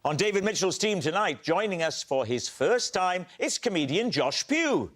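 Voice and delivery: upbeat TV panel-show introduction; show host announcer. Acted. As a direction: lift each guest's name for applause.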